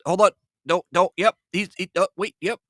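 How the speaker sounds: high, urgent re-enacted calls; retold excited yelling. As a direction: act out frantically following a fish bite. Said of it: "as I'm yelling".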